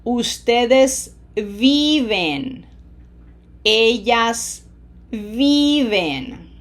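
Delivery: slow "viven" with a long stressed "vi"; pronunciation emphasis. Shown as a demonstration. Teacher models for students to repeat, long "vi" in "viven". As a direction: draw out the first syllable of "viven", pause before it. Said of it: "Feel the pronunciation emphasis".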